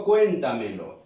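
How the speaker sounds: "cuéntamelo" with a strong first syllable; "cuéntamelo" dropping low after the stressed CUÉN. Shown as a demonstration. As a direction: hit CUÉN in "cuéntamelo", then let it fall. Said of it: "Y digo".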